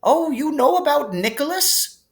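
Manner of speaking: high, pleased surprise; delighted. Acted. Dumbledore delighted Harry knows of Nicholas, pleased lift. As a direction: brighten up with delight at the name. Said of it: "sounding quite delighted".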